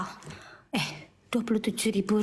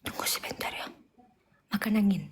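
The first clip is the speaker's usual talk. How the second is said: whisper. Real, not acted. Hushed asides awaiting delivery.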